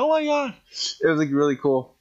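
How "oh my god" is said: high-pitched imitated squeal of delight; thrilled greeting. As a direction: retell a delighted gasp of recognition. Retold reaction while hugging her.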